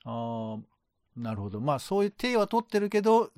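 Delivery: normal talking voice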